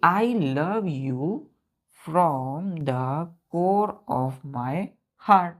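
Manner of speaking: each word said slowly, one by one; slow drawn-out phrase. Shown as a demonstration. Teacher reads the sentence to children, very slow. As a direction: spell it out slowly for the class. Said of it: "क्या बोला गया बच्चों".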